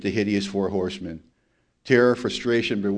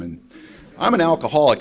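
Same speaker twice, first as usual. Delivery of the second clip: usual speaking voice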